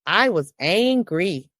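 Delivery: slow, weighty repeat; slow emphatic repeat. Real, not acted. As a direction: lean on each word slowly. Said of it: "repeated slowly for weight".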